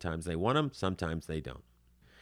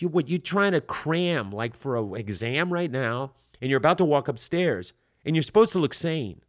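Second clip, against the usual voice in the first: usual speaking voice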